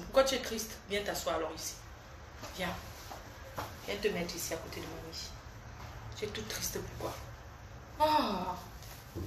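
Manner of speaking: hushed, real comforting murmur; tender, concerned. Real, not acted. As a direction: gently coax a little one over. Comforting a sad small child.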